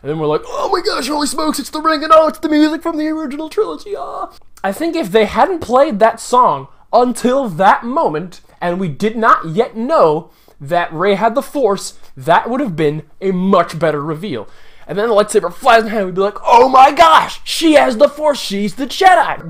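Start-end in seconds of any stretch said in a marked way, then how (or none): 0.4-4.3 high-pitched, gushing fake thrill; mock fan excitement. Acts thrilled audience hearing music. 16.5-19.5 loud, high exclamation of awe; mock amazed excitement. Acts audience thrilled by reveal.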